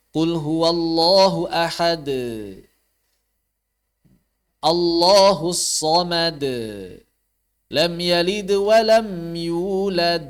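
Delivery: slow recitation, final d of "ahad" dragged into a vowel; qalqalah dipanjangkan. Shown as a demonstration. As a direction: lengthen the bounce after "samad" and "yulad". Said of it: "jangan dipanjangkan". (pantulan d pada "ahad", "samad", "yulad" dipanjangkan)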